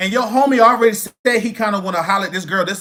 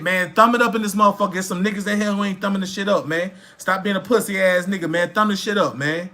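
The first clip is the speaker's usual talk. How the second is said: usual speaking voice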